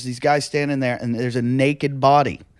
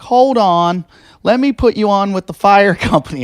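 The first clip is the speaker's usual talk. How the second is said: higher, louder operator voice; brisk operator; 8 semitones higher, 5 dB louder than usual. Retold or imitated. Dispatcher transferring a panicked caller, brisk higher voice. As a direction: chirp it like a busy dispatcher. 911 operator puts him through.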